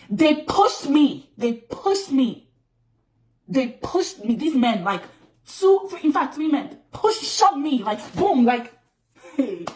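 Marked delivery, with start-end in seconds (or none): 0.1-2.5 each word slow and heavy, louder; slow emphatic repeat. Real, not acted. Telling viewers she was physically shoved, slow and emphatic. Stressing the shocking assault. 3.5-8.4 higher, louder, rushed outrage; agitated recounting. Real, not acted. Recounting the three men shoving her, agitated.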